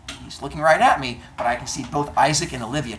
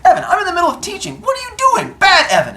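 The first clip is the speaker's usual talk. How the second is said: high, fast, loud exasperated scold; teacher retells scolding distracted student, high and exasperated; 7 dB louder, faster than usual. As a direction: scold the kid in mock disbelief. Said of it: "I was like".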